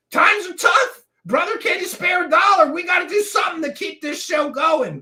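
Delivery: high, loud hard-luck appeal; hammed-up begging for spare change; mock beggar's plea. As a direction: plead like a struggling showman passing the hat. Plays a pleading panhandler for money.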